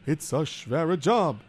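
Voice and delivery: put-on worried Yiddish-accented kvetching. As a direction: complain like a hapless schlemiel. Figure explains.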